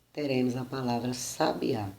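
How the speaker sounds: sabiá stressed on final Á; stress on last syllable. Shown as a demonstration. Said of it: "Se na terceira, no último A".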